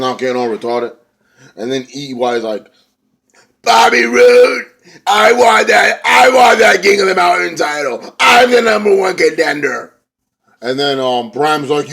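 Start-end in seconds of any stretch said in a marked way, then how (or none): 3.6-9.9 high, very loud promo yell; drawn-out, full-voiced mock shout; shouted wrestler's challenge. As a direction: holler it like a wrestler calling someone out.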